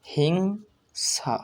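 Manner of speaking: হিংসা very slow, hing held, falling sa; pronunciation demo. Shown as a demonstration. Teacher sums up the spelling, হিংসা drawn out. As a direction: stretch the ng in হিংসা, then separate sa. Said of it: "এর উচ্চারণ কিভাবে করতে হয়".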